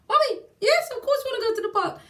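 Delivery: put-on high, loud, fast child voice; excited kids. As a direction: squeal yes like a thrilled little kid. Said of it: "They're shocked".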